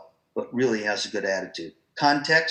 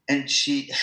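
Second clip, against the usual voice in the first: usual speaking voice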